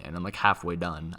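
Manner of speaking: ordinary delivery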